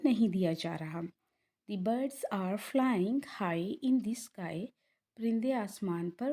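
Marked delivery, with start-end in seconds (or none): 1.7-4.6 slow, drawn-out English sentence; slow model reading. Shown as a demonstration. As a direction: read it slowly, word by word. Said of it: "हाई में देखिए जी की sound नहीं". (धीमे-धीमे पढ़ते हुए)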